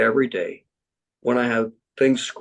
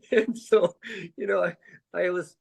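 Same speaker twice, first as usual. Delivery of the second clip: laughing; 8 semitones higher than usual. Real, not acted. Laughter carries into speech.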